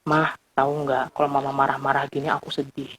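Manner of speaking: soft, sincere and low; gentle I message. Retold or imitated. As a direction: quietly tell mom how you feel. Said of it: "kita bisa bilang misalnya gini". (anak berbicara lembut kepada mama)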